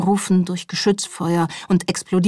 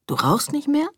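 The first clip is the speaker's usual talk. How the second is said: disbelieving question, pitch climbing high at the end; incredulous; 8 semitones higher than usual. A narrator's reading. He asks Lee about her not smoking, incredulous. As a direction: ask it in disbelief, voice lifting sharply. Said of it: "fragte er ungläubig".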